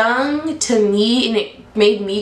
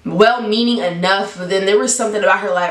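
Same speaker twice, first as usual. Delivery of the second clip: usual speaking voice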